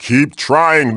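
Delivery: ordinary talk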